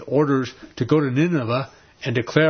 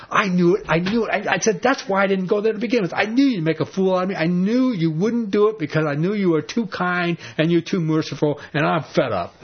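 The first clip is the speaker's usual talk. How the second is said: Jonah fed up; 6 semitones higher than usual. Retold or imitated. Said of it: "He says".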